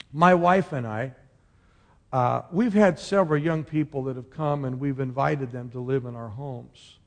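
usual speaking voice

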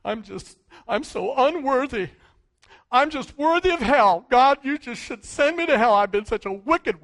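groveling wail, wicked repeated; lightly voiced self-pitying believer; mock self-pity. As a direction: play the wallowing unworthy sinner. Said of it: "people say this".

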